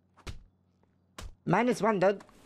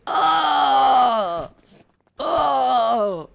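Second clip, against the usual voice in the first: death sound. Pretend. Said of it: "make death sound".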